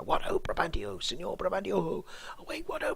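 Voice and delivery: high, loud alarm call into the night; alarm shout. Acted. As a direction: shout it out like crying fire. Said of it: "if you were shouting out fire".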